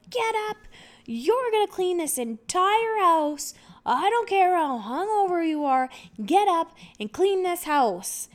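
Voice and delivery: high-pitched imitation of a scolding mother; bad-cop mom. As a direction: order the hungover kid up in a high, stretched voice. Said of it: "My mom's the bad cop".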